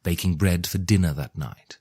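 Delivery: ordinary delivery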